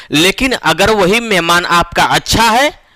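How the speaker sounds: normal talking voice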